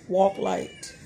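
ordinary talk, nothing marked

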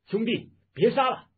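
loud, high call across a battle; full-voiced shout. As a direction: shout to stop a brother fighting. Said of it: "当下纵声叫道".

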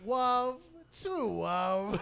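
loud lisping priest, wov for love; Princess Bride priest. Retold or imitated. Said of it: "Remember The Princess Bride?".

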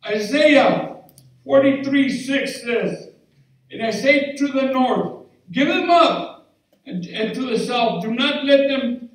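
loud, high preaching; fervent preacher voice; preaching at full force. Acted. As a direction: proclaim the scripture boldly.

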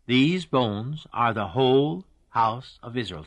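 slow, deliberate weight on "These bones" and "whole house"; emphatic repetition. Real, not acted. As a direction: stretch "whole" and set "house" apart. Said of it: "I'm emphasizing that".

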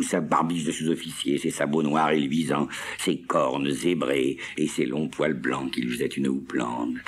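ordinary delivery

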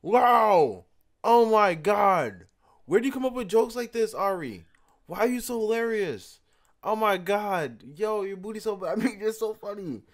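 high, gushing mock amazement; exaggerated high exclamations, winding down from laughter; hammed-up hysterical amazement. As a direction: gush as if it's the funniest joke ever. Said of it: "I'm gonna literally laugh my ass off".

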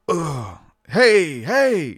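jolted grunt, then high, loud heys; imitated yell. Telling how worshippers let it out, mock yell. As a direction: yelp it out like a sudden jolt. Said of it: "you know, you'd yell".

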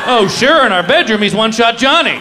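high, loud sarcastic complaint; ticked-off wife. Retold or imitated. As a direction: imitate an annoyed wife mocking her husband. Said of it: "My wife was all ticked off".

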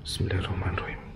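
nervous whisper; whispered prayer. Real, not acted. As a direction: breathe the prayer out scared. Scared entering dark basement.

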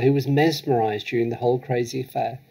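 usual speaking voice

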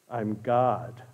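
low, soft, unhurried answer from above; God's voice. Acted. God answers the hanging man, low and quiet. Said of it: "he hears a voice".

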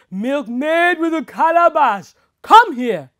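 loud, high booming call; bellowing. Acted. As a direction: bellow from inside the tree. Tree calls the milkmaid.

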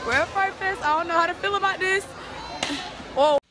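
loud, high excited yelling; excited shouting. Real, not acted. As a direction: shout it out, thrilled. Excited arrival at Fright Fest.

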